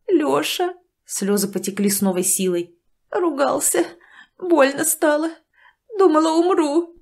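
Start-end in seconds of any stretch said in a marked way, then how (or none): 0.1-0.7 calling the name out higher, rising and pleading; a weeping mother crying out for her son; tearful cry. A narrator's reading. 3.1-6.9 higher, choppy phrases with pauses between; broken tearful phrases. A narrator's reading. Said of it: "говорила женщина отрывистыми словосочетаниями".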